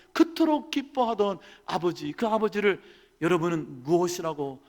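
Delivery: usual speaking voice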